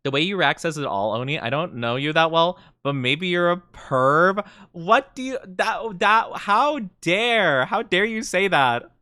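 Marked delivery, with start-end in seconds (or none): 4.7-8.9 mock outrage.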